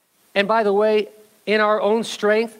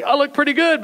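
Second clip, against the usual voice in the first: boastful; 7 semitones higher than usual. Pretend. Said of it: "don't get all boastful and say".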